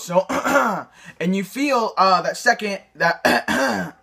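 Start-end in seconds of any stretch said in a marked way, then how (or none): 0.3-0.8 rough deliberate throat clear; throat clearing demo. Shown as a demonstration. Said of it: "act like you're clearing your throat".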